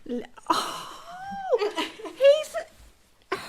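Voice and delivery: very high, laughing gasp; too delighted to finish the words; overjoyed laughing. Real, not acted. Great-grandmother showing off the baby, overjoyed laughing.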